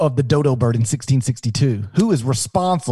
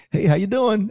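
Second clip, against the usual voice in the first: lightly voiced dodo, pitched up; a higher, casual hello from a trusting bird; 6 semitones higher than usual. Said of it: "The dodo bird just be like".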